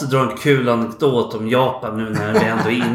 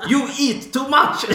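much higher, laughing mock Japanese-accented English; 10 semitones higher, slower than usual. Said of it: "Första han säger är". (skrattande imitation med japansk brytning)

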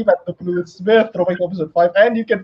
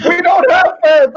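much higher, louder, drawn-out outcry; loud protest; 12 semitones higher, 7 dB louder, slower than usual. Real, not acted. Joking with co-hosts about having no fans, loud protest. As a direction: cry it out high, loud and slow.

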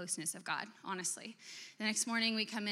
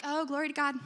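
higher, breezy fake-holy voice; mock pious; 5 semitones higher than usual.

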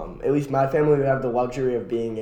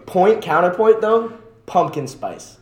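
triumphant; 5 semitones higher, 6 dB louder, slower than usual. Real, not acted. Triumphant counterpoint in debate.

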